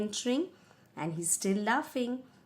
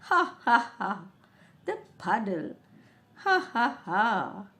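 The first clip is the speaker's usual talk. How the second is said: king laughing; 3 dB louder than usual. Acted.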